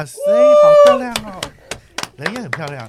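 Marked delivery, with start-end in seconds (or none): 0.0-1.4 excited. Real, not acted.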